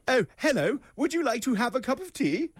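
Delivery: high-pitched, over-the-top British politeness; mock-British, pitched well up; exaggerated British accent. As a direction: put on a silly, overdone British accent. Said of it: "they'll put on that accent".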